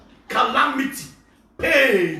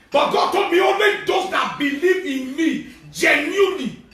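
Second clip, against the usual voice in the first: preaching at full force; 5 semitones higher than usual. Acted. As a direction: declare it slowly. Loud proclamation.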